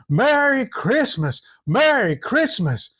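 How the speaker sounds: high, slow drawn-out holiday call; yelling greeting. A narrator's reading. Papa greeting the family from the wagon, drawn-out yell. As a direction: stretch out each word like a distant holler. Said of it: "he was just yelling".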